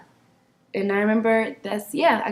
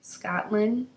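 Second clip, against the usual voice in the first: gentle advice; 2 semitones lower, 10 dB quieter, slower than usual. Real, not acted. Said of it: "To my younger self".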